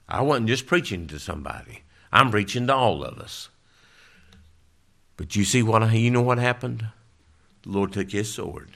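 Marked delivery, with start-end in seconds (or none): none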